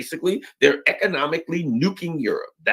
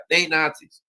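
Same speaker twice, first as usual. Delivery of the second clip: normal talking voice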